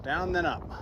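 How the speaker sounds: ordinary talk, nothing marked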